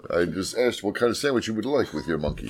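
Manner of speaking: mock Central African accent. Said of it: "For our Ethiopian and Central African listeners".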